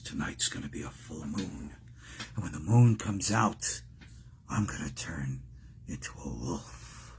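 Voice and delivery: low, hushed werewolf confession; Lon Chaney. Acted. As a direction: confide a dark curse, very low and soft. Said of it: "Lon Chaney, of course, who played".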